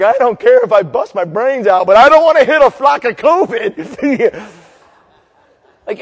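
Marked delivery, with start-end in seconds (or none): 0.0-3.7 loud mock biker, rising higher; reckless biker. Preacher voices helmetless motorcyclist, loud comic defiance. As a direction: holler it over the wind, half laughing. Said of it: "the guy's like".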